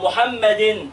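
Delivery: MUHAMMADIN, slow with drawn-out "-din"; tanween kasr. Shown as a demonstration. Teacher shows the second ending to pupils, slow MUHAMMADIN. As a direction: hold the final N of MUHAMMADIN. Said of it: "إيه المشترك بين التلاتة دول".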